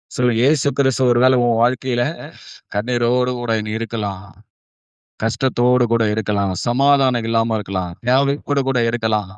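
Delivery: usual speaking voice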